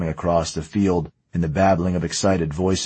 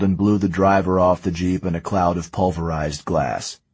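ordinary delivery

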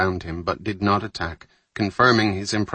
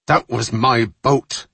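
slow, louder snarl of bitter anger; 4 semitones higher, 6 dB louder, slower than usual. Acted. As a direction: bite out each word, hurt and furious. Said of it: "Roselli snarled".

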